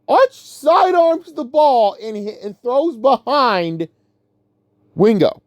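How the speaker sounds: high, drawn-out cry of disbelief; exasperated outburst. Real, not acted. Fan reliving a throw that almost made him lose it, exasperated. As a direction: voice climbs high and stretches in exasperation. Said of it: "And I almost lost it".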